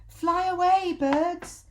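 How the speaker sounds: high, loud monkey shout. Acted. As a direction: yell up at the birds to warn them. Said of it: "they used to shout".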